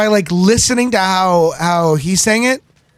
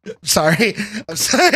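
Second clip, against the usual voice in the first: laughing; 8 semitones higher than usual. Real, not acted. Breaks up laughing.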